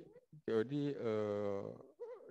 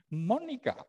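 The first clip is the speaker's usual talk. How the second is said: calling out; 7 dB louder than usual. Real, not acted. Calling a student on call.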